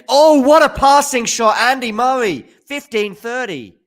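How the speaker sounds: excited call. Real, not acted. Commentator calling a stunning passing shot for viewers, thrilled yell. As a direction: shout the winner as it happens.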